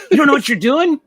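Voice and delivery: loud, high-pitched jab ending in "eh"; passive-aggressive demo. Shown as a demonstration. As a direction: needle them, hammed-up Canadian style. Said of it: "A makes it passive aggressive".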